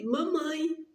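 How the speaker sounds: put-on a weak, out-of-breath child calling his mother; faint thin child's call. Sick little son calling his mother, faint and breathless. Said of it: "uma voz distante, muito fininha, muito ofegante".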